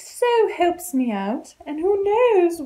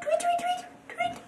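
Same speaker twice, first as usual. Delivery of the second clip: squeaky high tweets, very soft; cartoon bird voice; 14 semitones higher, 12 dB quieter, faster than usual. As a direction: chirp like a tiny bird. Imitates the bird.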